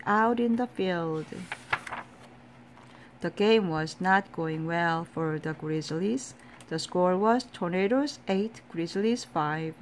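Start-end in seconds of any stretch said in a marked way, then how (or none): none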